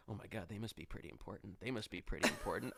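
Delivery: soft, low murmured gossip; hushed onlookers. Retold or imitated. Onlookers gossip as the group walks past, hushed. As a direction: imitate bystanders murmuring among themselves.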